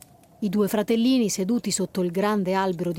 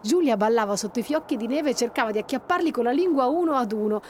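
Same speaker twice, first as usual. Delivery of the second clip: ordinary delivery, nothing marked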